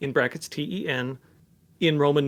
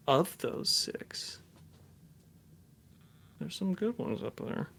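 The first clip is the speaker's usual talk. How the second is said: whispering; 3 semitones higher, 9 dB quieter than usual. Real, not acted. Boasting quietly about his nominations.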